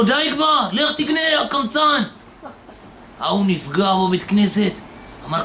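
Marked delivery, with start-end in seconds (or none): 0.0-2.0 higher, annoyed rebuke; mildly raised retold scolding; mock scolding. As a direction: tell the cheapskate off. Congregant scolds moocher during prayer.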